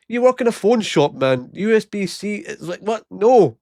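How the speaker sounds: high, incredulous scolding; exasperated retort. Retold or imitated. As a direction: talk down to the clueless clerk. Exasperated retort to phone salesman.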